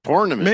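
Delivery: "tournament" said as "tornament"; imitated pronunciation. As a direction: echo "tournament" with OR, TOR-nament. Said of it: "I also heard him say tournament".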